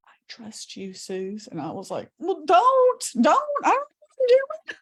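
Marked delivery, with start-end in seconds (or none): 0.1-1.5 barely voiced, breathy whisper; mouthed whisper. Mother mouthing trust to her daughter, whispered. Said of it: "she mouths". 2.2-4.8 high, loud panicked protest. Retold or imitated. Daughter panicking as her mother's breathing fails, high and loud.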